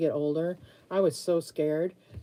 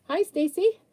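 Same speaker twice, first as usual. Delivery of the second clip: high, loud hello to a viewer; bright high greeting; 13 semitones higher, 6 dB louder than usual. Real, not acted. Greeting a viewer named Stacy in a high, loud voice. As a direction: sing out the name in a high greeting.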